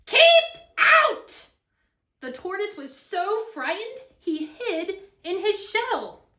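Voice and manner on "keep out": two loud, separate high blasts; angry yell. Acted. Cactus to intruding tortoise, yelling. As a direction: order the intruder away, shouting. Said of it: "yelled Hank".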